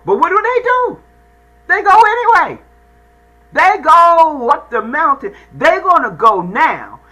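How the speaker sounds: high, loud rising preacher's cry; soaring sermon climax, loud and high; preaching at full force. Real, not acted. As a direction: lift the congregation with the punchline.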